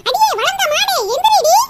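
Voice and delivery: shouted rebuke, hitting the last word hard; angry yelling. Acted. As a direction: yell at a lazy sleeper to get up. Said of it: "காட்டு கத்த கத்திக்கிட்டு இருக்கேன்".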